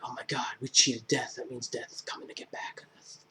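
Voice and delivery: hushed ominous whisper, fading to breath; spooky whisper. Retold or imitated. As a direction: let it sink to a scared whisper.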